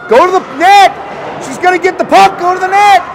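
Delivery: high, urgent calls; excited play-by-play. Real, not acted. Announcer sending the player to the net, urgent and excited. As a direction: urge the skater on from the booth.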